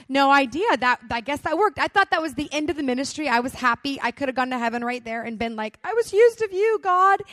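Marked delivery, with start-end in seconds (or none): 5.8-7.2 high, loud, ecstatic; joyful. Pretend. Imagining thanking God in heaven, high and joyful. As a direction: cry it out to God in delight.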